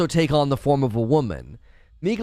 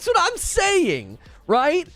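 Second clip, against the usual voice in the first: laughing; 13 semitones higher, slower than usual. Real, not acted.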